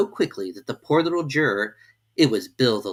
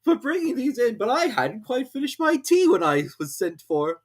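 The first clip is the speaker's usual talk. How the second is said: a nervous hatter excusing himself; Hatter's voice; 12 semitones higher than usual. A narrator's reading. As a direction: Hatter apologizing to the king. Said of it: "he began".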